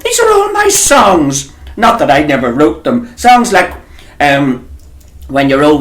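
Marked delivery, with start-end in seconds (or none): 0.0-1.5 high, slow playful outrage; high teasing complaint, stretched out; mock protest. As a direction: squeal it at him in mock dismay. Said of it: "I says".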